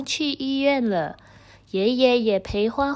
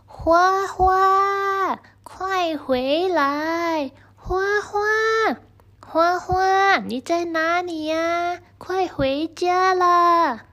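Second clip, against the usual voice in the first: calling out; 8 semitones higher, slower than usual. Acted. Grandpa searching everywhere for Huahua, high slow calls. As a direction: call far across the fields, drawn out. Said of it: "喊着".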